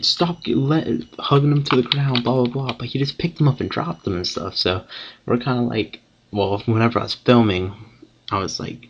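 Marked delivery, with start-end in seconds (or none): 0.0-2.7 raised, high-pitched mock yelling; retold scream. Kid protesting during the fight, high retold scream. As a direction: imitate someone yelling out, pitched up. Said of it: "this kid would scream saying".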